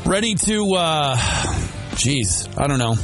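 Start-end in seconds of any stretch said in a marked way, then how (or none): none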